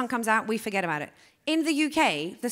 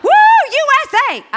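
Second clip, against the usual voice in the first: high, loud chant; mock American cheer; 14 semitones higher, 14 dB louder than usual. Comic mimics the loud American in the audience, whooping. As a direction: whoop it like a rowdy fan.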